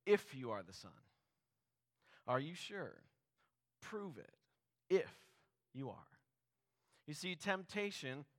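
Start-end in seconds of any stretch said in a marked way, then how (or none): 0.1-6.2 lightly voiced devil needling Jesus to prove it; taunting devil. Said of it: "Then the devil says".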